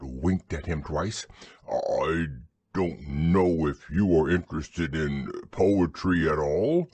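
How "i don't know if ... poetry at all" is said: low, ponderous bear voice; dim, gentle bear thinking aloud; Pooh. Acted. Pooh's voice in story reading.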